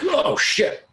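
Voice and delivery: the jolt of being grabbed mid-sentence; mock panic. Panicked reaction to sudden attack.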